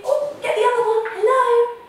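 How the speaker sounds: high, loud and quick; a loud, quick, high rush to answer; excited. Acted. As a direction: hurry to grab the radio. Rushing to answer radio.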